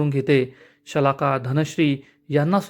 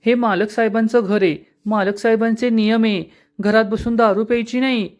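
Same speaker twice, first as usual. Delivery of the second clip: mocking mimicry; 7 semitones higher than usual. Mocking Anandi's words.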